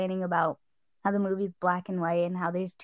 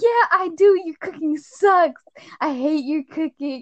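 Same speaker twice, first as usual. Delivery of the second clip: mock cruel reply; 7 semitones higher, 6 dB louder than usual. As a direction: lean into the cruelty, hammed up. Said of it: "Robert Pattinson's like".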